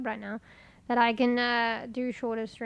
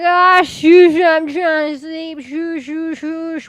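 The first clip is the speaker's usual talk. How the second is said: loud, higher mock shushing; sleepy shushing cats; 5 semitones higher, 14 dB louder, slower than usual. Streamer retelling shushing her cats at night, loud mock shush. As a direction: hush the cats, louder and higher.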